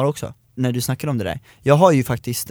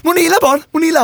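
high, loud, frantic mother's questions, much higher; mother grilling her kids in a panic; frantic mom; 6 dB louder, much faster than usual. Retold or imitated. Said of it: "säger mamma till oss".

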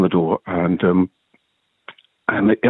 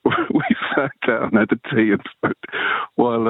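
usual speaking voice

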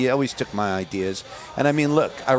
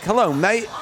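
mock cheery British hello; 8 semitones higher than usual. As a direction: toss off a cheery "mate" as a rock star might. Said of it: "and he's like".

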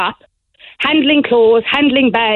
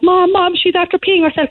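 higher, mock child tattling to mom; lightly voiced small daughter, higher; imitating little girl; 8 semitones higher than usual. Young daughter telling mom about sister, mild child voice.

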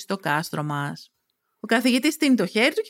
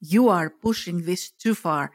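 low, controlled warning; stern warning; 4 semitones lower than usual. Acted. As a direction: warn them in a lowered, even voice. Luca warns, heroine then echoes it. (αυστηρή, χαμηλόφωνη προειδοποίηση)

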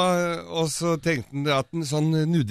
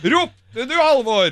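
high, louder teasing call; teasing callback; 10 semitones higher, 6 dB louder, much slower than usual. Acted. [name] teases host Halvor with the punchline, high and louder.

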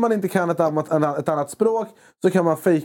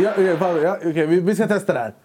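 ordinary delivery

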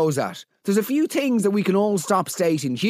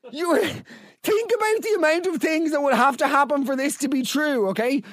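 high-pitched exasperated disbelief, laughing at first; comic rant, voice pitched right up; incredulous outrage; 8 semitones higher than usual. Real, not acted. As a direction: protest in a high voice at an impossible story. Incredulous rant at listener's story.

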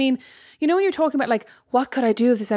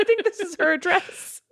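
high, half-crying with laughter; 9 semitones higher than usual. Pretend. Taxi driver bit continues.

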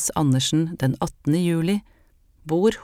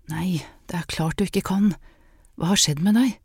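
urgent hushed whisper; 2 semitones higher than usual. Acted. Hanna at the door turning Anne away, whispering. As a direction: whisper so no one inside hears.

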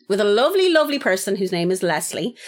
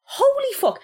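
amazed exclamation; slower than usual. Real, not acted. Amazed at actress's beauty.

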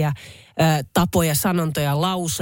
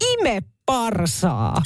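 very slow, drawn-out foreign call; awful-voiced local yell; 4 semitones higher, much slower than usual. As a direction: drag it out like a shouting local. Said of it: "huusi hirveällä äänellä". (hirveällä äänellä venytetty huuto)

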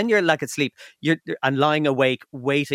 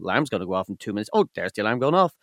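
weary fast mutter; 6 semitones lower, faster than usual. Retold or imitated. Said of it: "I was kinda going".

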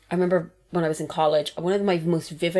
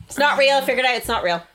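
high, loud protest; loud, high-pitched insistence; emphatic retraction; 6 semitones higher, 5 dB louder than usual. Acted. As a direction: call it out, then settle slightly. Emphatic comic retraction about baby.

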